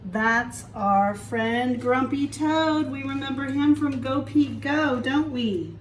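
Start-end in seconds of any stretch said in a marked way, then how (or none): none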